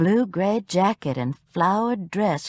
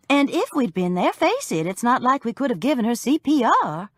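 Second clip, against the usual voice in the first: defensive; 7 semitones higher than usual. A narrator's reading. Narrator defends herself to Fred.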